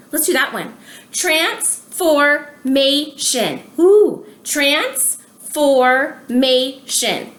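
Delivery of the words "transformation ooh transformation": stretched out slowly, syllable by syllable; slow syllable demo. Shown as a demonstration. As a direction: sound out each syllable for children. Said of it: "That's four syllables".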